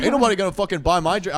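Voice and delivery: high, fast mocking of the guy; mock drunk guy. Quoting the rude guy at the bar, high and fast. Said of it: "he said, like".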